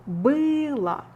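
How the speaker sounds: было stressed on ы, final о said as а. Shown as a demonstration. Teacher pointing out stress for students, slowly. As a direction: hit the ы in было and soften the end. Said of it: "stress follows on ы".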